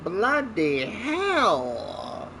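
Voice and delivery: usual speaking voice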